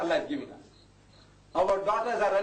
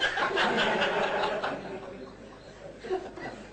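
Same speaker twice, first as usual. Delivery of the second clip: a high, airy burst of laughter; high unvoiced laugh; high breathless laugh; 13 semitones higher than usual. Real, not acted. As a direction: laugh out at your own joke. Laughing at beard joke.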